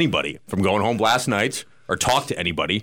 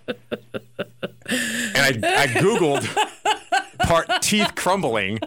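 laughing; 7 semitones higher than usual. Real, not acted. Laughing about googling.

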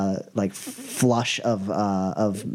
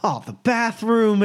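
high, drawn-out lament; acted dismay; 11 semitones higher, 4 dB louder than usual. Boss hears of his bathroom, high mock dismay. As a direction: wail it lightly, stretched out.

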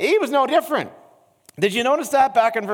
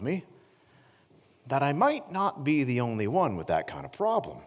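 quiet earnest preaching; 8 semitones lower, 7 dB quieter than usual. Acted. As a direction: draw them in close and confess gently. Intimate quiet preaching section.

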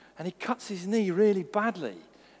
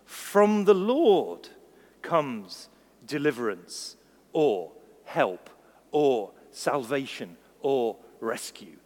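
solemn scripture; 2 semitones lower, much slower than usual. Acted. Slow solemn scripture reading.

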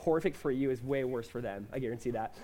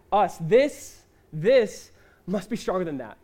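emphatic repeat; 6 dB louder, slower than usual. Real, not acted. Preacher repeats the phrase.

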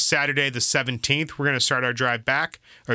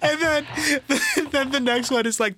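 high-pitched, talking through real laughter; laughing; 12 semitones higher than usual. Real, not acted. Friend retelling a comedy sketch, helplessly laughing. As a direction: struggle to get it out while cracking up.

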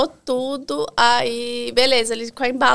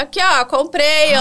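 retold taunt; 4 semitones higher, 4 dB louder than usual. Customer showing the rude vendor her purchase, taunting call.

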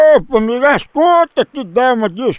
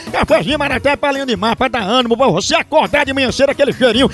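upbeat radio host reading a live coffee ad; punchy radio announcer pitching coffee; 3 semitones higher, faster than usual. Acted. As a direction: sell it like a lively radio spot.

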